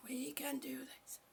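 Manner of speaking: soft whispered encouragement; whispered pep talk. Retold or imitated. Urging her own eyes, a soft whispered pep talk. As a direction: coax it gently in a whisper. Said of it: "And I'm saying".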